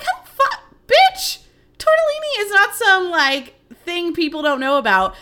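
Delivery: high, loud, mock-outraged rant at Kim; playfully furious, as if it's unthinkable; outraged disbelief. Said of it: "I'm like".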